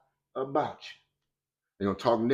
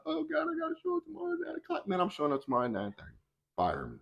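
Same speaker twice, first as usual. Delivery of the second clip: mock-whine, then shrug it off; whiny defiance; 12 semitones higher, 9 dB quieter, faster than usual. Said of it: "you're like".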